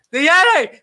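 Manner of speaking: very high, loud horror-film cry; mock terrified shriek. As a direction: shriek it in hammed-up terror. [name] screams at the Yeti.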